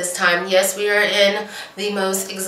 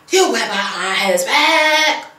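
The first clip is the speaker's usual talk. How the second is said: slow, put-on gossip voice; mock scandalized gossip; 3 semitones higher, slower than usual. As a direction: savor the drama, drawn out. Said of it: "she's like".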